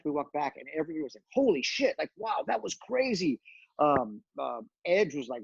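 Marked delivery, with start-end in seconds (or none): 1.3-3.4 high-pitched amazed exclamation, retold; amazed reaction. Wrestler retelling the backstage reaction to the match, amazed. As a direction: imitate a crowd blown away.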